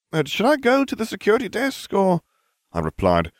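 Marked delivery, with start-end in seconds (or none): none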